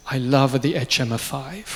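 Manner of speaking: put-on slow, drawn-out Italian accent. Fabrizio surprises an admirer with his love, imitated Italian accent. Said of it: "I'm gonna use an Italian accent".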